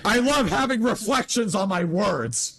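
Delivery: high, drawn-out mock delight; sarcastic enthusiasm. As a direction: gush about it sarcastically, stretching the words. Mocking audio ray tracing as meaningless.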